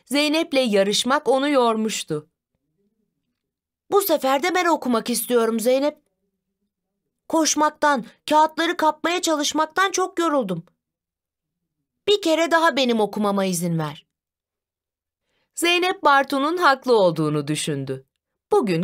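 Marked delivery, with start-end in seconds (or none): 3.9-14.1 put-on mild child-like plea, a little higher; lightly voiced Bartu pleading. Tired brother asks sister for a turn, mild higher-pitched plea. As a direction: plead lightly in a small raised voice.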